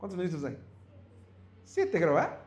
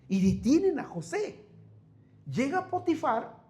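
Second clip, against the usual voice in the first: normal talking voice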